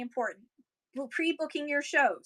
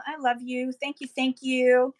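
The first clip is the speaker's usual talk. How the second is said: ordinary delivery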